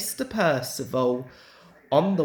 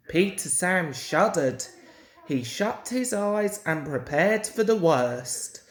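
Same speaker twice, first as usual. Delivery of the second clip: plain reading voice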